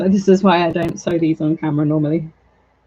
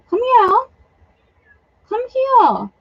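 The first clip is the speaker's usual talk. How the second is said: calling the cat; 14 semitones higher, slower than usual. Real, not acted. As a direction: coax the cat over in a high voice.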